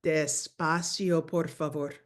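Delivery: slow model phrase; teacher modelling slowly; slow, drawn-out. Shown as a demonstration. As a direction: say it slowly, clearly. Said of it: "we need to say".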